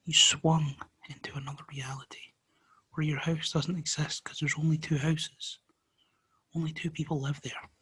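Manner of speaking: hushed spooky whisper. A narrator's reading. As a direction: whisper it like a mystery. Spooky reveal of other reality.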